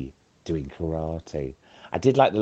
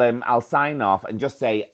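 normal talking voice

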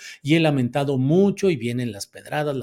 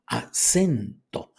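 "acento" very slow, broken as a... SEN... to; stress on SEN; much slower than usual. Shown as a demonstration. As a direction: stretch the middle syllable of "acento". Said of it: "el acento prosódico".

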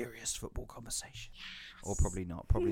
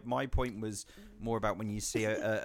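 ordinary delivery

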